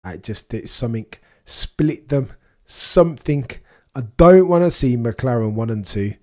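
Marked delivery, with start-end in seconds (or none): 1.4-6.1 loud, high plea to split them; excited urging. Real, not acted. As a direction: urge the drivers on with feeling. Urging drivers during qualifying.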